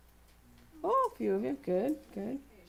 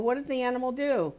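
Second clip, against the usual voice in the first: ordinary delivery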